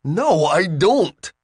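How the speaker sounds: Bill, slow and pleading in high pitch; trapped and pleading, drawn out; desperate. A narrator's reading. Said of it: "desperation in his voice".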